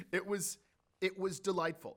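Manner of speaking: ordinary delivery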